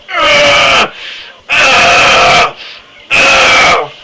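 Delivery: loud strained grunts from the gut; grunting groan. Shown as a demonstration. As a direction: grunt like you're pushing hard. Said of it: "the best way to describe it is like grunting and groaning".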